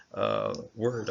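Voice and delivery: ordinary talk, nothing marked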